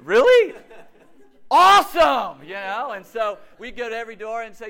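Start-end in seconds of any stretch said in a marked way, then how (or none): 0.1-2.4 loud, high-pitched mock amazement; thrilled disbelief. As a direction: shout it, hammed-up and thrilled. Mock reaction to accent plan.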